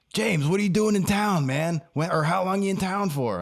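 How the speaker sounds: high, excited storytelling buddy; Stone Man voice. Retold or imitated. Stone Man telling his friend the dog park story, high and eager. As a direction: tell it like a thrilled old friend.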